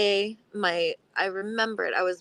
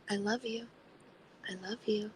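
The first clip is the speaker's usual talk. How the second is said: whispered, much softer I love you; soft loving whisper; 7 dB quieter than usual. Retold or imitated. Answering the inner critic, whispered softly. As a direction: breathe it gently to yourself. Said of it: "I will just immediately say".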